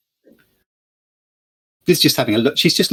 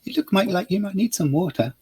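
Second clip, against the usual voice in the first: put-on sweet young woman; 7 semitones higher than usual. Lily offers water.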